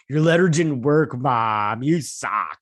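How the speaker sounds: loud, exaggerated sulky outburst; mock a whiny kid blaming Mom; bratty baby tantrum. Son blaming his mom about the letter, bratty imitation. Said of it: "He didn't say it like that".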